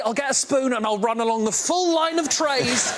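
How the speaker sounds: ordinary talk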